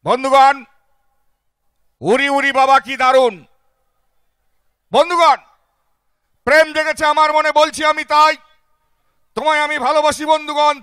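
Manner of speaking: mock political rally oration; politician's speech. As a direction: thunder it like a netamashai from the stage. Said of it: "ভাষণের আকারে কিরকম ভাবে বলছে দেখুন".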